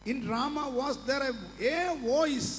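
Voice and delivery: each word drawn out slowly for the congregation; slow verse reading. Shown as a demonstration. Preacher reading the English verse to the congregation, very slowly.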